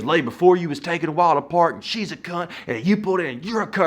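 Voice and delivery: high-pitched imitation of an irate man; angry rant. Stranger complains to the man about parking, angry rant. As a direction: rant it like a worked-up hothead.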